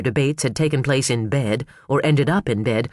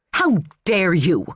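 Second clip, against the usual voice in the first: snapped; 4 semitones higher, slower than usual. A narrator's reading. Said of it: "she snapped".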